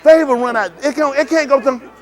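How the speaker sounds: exclaiming. Acted. Skit exclamation, loud.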